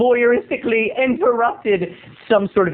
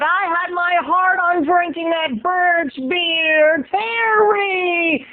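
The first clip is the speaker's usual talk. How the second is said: high, slow wail of complaint; imitating Laura; 11 semitones higher, much slower than usual. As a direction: whine it high and drawn out. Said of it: "she said".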